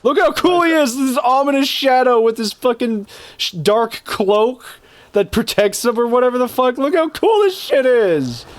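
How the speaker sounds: high, hyped-up gushing; giddy high excitement; excited gushing. Real, not acted. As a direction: gush over the boss like a fan. Hyping up boss design.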